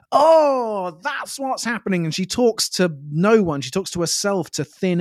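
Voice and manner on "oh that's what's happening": higher, dawning realization; Doctor realization voice. Retold or imitated. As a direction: say it like a sudden discovery. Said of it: "lifts both her hands up and goes".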